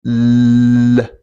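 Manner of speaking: long held light L, tongue coming down from the top. Shown as a demonstration. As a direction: hold the L, then release it downward. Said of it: "it goes like this".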